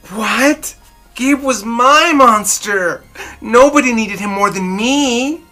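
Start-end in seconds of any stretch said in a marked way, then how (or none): none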